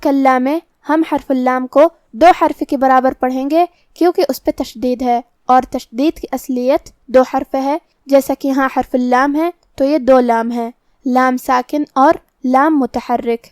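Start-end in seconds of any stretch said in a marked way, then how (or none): none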